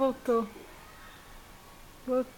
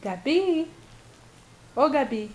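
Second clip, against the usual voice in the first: ordinary delivery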